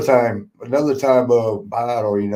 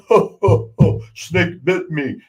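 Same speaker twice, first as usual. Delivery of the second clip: giggling Andre; 4 semitones higher, slower than usual. Retold or imitated. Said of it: "he's giggling".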